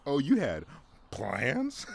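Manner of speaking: loud, high mocking sneer at someone's plans; a hammed-up gloating taunt. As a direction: taunt them like a bully. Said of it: "It's like".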